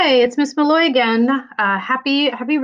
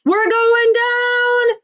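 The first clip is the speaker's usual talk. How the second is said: high, slow, stretched-out alarmed yell; man shouting; 12 semitones higher, 4 dB louder, much slower than usual. Acted. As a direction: cry out that the ship is going down. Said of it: "a man shouted".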